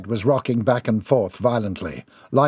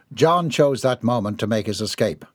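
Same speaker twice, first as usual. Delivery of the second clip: usual speaking voice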